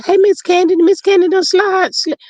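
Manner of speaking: high, quick-fire excited greeting; excited calling out. Real, not acted. Host hailing Miss Candy in the chat, excited calling out. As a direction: call out to her in a high voice. Calls out to arriving viewer Candy.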